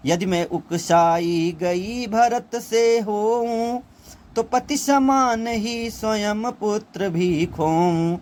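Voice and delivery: Kaikeyi's lament recited slowly as verse; poem recitation. Acted. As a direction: lift the line and stretch it like recited poetry.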